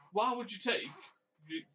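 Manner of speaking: normal talking voice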